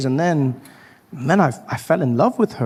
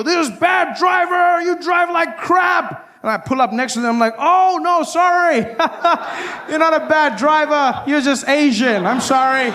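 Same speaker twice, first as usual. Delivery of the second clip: mock road rage; 12 semitones higher than usual. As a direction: shout at the driver, then backpedal sheepishly. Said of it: "and I go".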